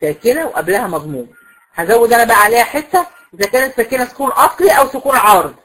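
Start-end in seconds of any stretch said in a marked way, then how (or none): none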